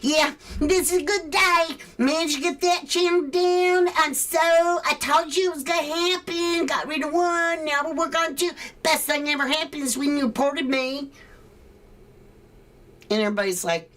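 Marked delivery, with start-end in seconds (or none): 0.0-11.1 high, gloating mock voice; mocking spud head. Mocking a smug rival who gloats about a takedown, high mock voice. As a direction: sneer like a smug fool bragging. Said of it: "is a giant spud head going".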